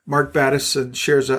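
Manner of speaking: usual speaking voice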